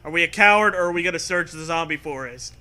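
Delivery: ordinary delivery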